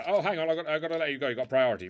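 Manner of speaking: ordinary delivery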